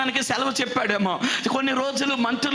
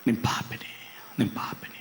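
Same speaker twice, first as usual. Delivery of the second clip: whispered confession; 11 semitones lower, 7 dB quieter than usual. Acted. David's confession voiced.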